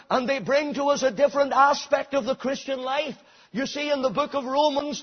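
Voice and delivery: high, loud pulpit preaching building to a shout; full-force preaching. Acted. Preacher rousing his congregation, at full force. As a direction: preach it hard to the back pews.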